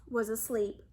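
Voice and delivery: usual speaking voice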